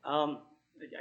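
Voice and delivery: ordinary talk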